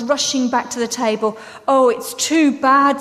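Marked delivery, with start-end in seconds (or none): 1.7-3.0 louder, slow lament; understated narrated distress; mild acted sob. A narrator's reading. Lucy finds Aslan's body gone, mildly tearful lament. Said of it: "sobbed Lucy".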